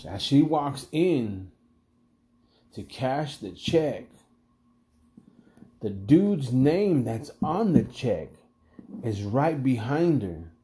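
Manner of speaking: slow, deliberate reveal with long pauses; suspenseful build. Real, not acted. Storyteller setting up the bad luck at check cashing, suspenseful. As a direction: draw out each phrase toward the twist.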